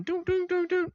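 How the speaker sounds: mock computer beeps. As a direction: hum it like a gadget scanning. Imitating an AI scanner.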